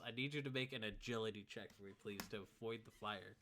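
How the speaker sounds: normal talking voice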